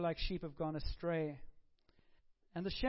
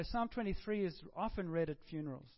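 ordinary talk

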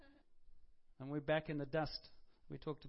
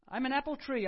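much louder and higher proclamation; loud declaration; 7 semitones higher, 9 dB louder than usual. Shown as a demonstration. Preacher declaring his own destiny, proclaimed loud and high. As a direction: proclaim it boldly to the room. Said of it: "I declare".

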